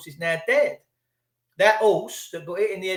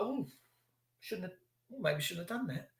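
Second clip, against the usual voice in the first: sheepish mutter; 15 dB quieter than usual. Retold or imitated. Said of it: "they're like".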